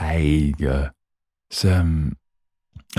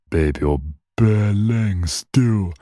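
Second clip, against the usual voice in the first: mock roadman; 5 semitones higher, 3 dB louder, much slower than usual. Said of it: "people are like".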